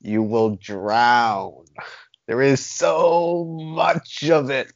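very slow, each word stretched out; drawn-out dire warning. Real, not acted. Co-host warning the others about too much content, drawn-out and dire.

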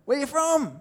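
loud, high Aussie question; exaggerated Aussie. Aussie quizzes a newfound countryman, loud broad Australian accent. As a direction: ask it in hearty broad Australian. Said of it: "you go back to Aussie".

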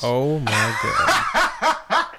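laughing in disbelief; squealing laugh. Real, not acted. Shocked laughing reaction.

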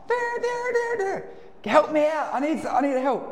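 very high sing-song there there, then pleading; high wheedling plea for help; pestering friend. Retold or imitated. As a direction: nag him like a stuck alarm. Pestering at the door.

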